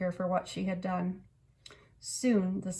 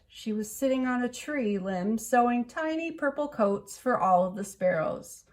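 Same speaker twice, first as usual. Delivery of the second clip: ordinary delivery, nothing marked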